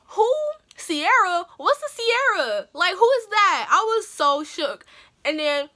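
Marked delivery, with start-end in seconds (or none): 0.1-3.7 high, stunned who-me; can't believe her name was called; stunned disbelief. Retold or imitated. Girl hearing her own name called, stunned disbelief.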